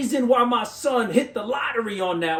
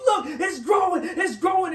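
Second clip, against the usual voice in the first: high, thrilled shout; a boy shouting with joy at his sprouting plant; excited kid; 9 semitones higher than usual. Retold or imitated. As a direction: announce it like the best news ever. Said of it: "And he was so happy".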